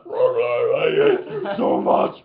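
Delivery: played backwards. Imitating a cartoon rewound on VHS, slowed down. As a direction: stretch it out like a tape in reverse. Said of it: "rewinding the V H S".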